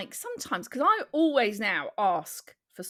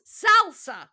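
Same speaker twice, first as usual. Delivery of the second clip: higher, louder insistent answer; exasperated repeat; 7 semitones higher, 8 dB louder than usual. Acted. Customer asked again which sauce, exasperated. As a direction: hurl the word at a baffled server. Acted second repeat of order.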